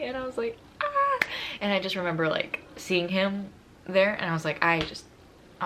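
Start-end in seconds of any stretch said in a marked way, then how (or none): 0.8-1.2 very high mimicked cry; imitated squeal, far above usual pitch; high overcome squeal. Girlfriend asked to marry him, high mimicked squeal. Retold reaction to marriage question.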